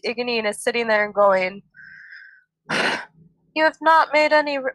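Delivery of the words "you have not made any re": half-started southern accent; starts the line with a hint of southern accent; southern accent, first try. Shown as a demonstration. Podcast host starts reading Egeanin's line in a southern accent. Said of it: "she has a southern accent".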